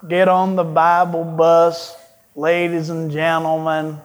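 put-on slow, low radio preacher accent; drawn-out, low imitation of an old radio Bible teacher; J. Vernon McGee. Pastor quoting a beloved radio preacher to church, slow accented impression. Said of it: "with his accent of".

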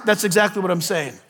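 usual speaking voice

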